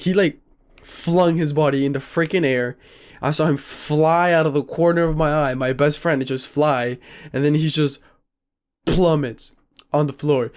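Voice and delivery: usual speaking voice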